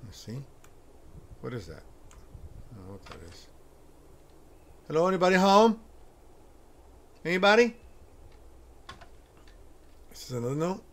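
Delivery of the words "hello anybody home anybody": loud, high call into an empty house; calling into the dark. Real, not acted. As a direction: call out to whoever is there.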